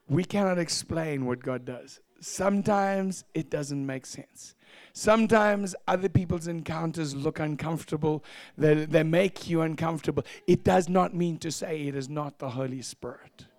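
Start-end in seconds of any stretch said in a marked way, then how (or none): none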